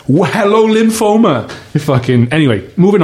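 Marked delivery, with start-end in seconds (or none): 0.1-1.5 mock cheerful greeting. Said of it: "It's like".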